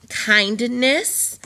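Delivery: kindness said slowly and drawn out; slow demo word. Shown as a demonstration. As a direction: stretch out kindness for the class. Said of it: "Your demonstration words for today".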